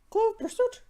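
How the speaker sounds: high-pitched theatrical voice; high dramatic title. Pretend. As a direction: announce the title high and hammy.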